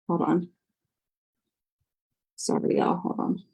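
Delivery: whispered apology off to the side; a quick real whisper; whispered aside. Real, not acted. Aside while handling kids.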